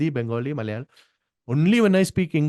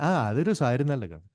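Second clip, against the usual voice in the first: ordinary delivery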